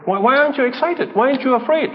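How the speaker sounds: the frightened disciple pleading with calm Elisha; alarmed pleading. Retold or imitated. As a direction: press him, half frantic.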